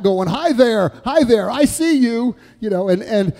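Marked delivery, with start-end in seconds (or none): 0.3-2.3 loud, raised-pitch excited holler; retold yell. Old man waving back at baby Eric, mock yell. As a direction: holler a greeting across a room. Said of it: "he was yelling back".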